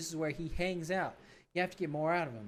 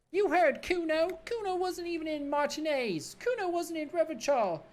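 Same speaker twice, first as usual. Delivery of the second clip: put-on bratty kid Kuno; 12 semitones higher, 3 dB louder than usual. Cocky kid mocking the cop, in a high bratty voice. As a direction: sneer it like a cocky street kid.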